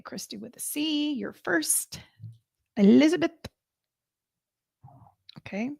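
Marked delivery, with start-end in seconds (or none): 0.0-2.0 soft voiceless whisper. Real, not acted. Host whispering to viewers while drawing names. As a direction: breathe it out without voice. Whispered aside during name draw.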